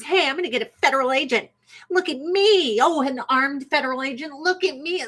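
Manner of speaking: lightly hammed-up protester voice; mock show-off protester. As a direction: ham up an attention-seeker. Mocking attention-seeking protester.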